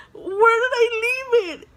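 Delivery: high, loud, near-crying wail; a retold child's panic, half sobbing; panicked wail. Panicked kid searching for money.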